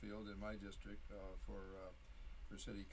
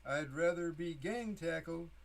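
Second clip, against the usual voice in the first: poetry reading; 5 semitones higher than usual. Acted. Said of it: "this poem that I call No Fun".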